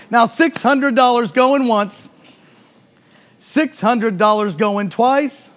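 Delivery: slow, drawn-out going once, going twice; auctioneer call. Real, not acted.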